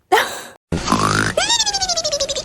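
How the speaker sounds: big squealing laugh; high laugh. Real, not acted. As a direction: burst out in a shrill laugh. Reader laughing at the scene.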